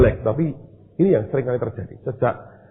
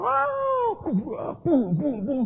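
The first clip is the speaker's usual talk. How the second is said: retold shout; much slower than usual. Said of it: "Ada orang teriak".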